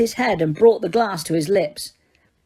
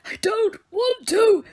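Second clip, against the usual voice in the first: high, strained pleading that breaks into a shout; old man shouting in terror; terrified begging; 13 semitones higher than usual. Acted. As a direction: beg like a frightened child. Poisoned old wizard begging.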